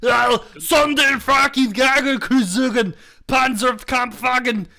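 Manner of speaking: loud mock German shout; German accent. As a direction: bark it in hammed-up German. Mock German tank designation.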